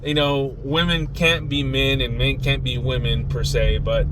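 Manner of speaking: ordinary delivery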